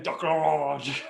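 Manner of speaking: "duck l'orange" with the last word drawn out; hammed-up mangled French "l'orange"; slaughtered French. Real, not acted. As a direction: overdo "duck l'orange" clumsily, then laugh. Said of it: "I apologize for slaughtering it".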